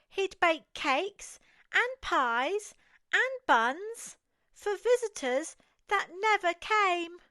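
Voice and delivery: ordinary delivery, nothing marked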